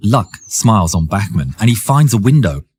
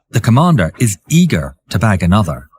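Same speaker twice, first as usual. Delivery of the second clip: plain reading voice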